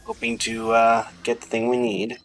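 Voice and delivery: ordinary talk, nothing marked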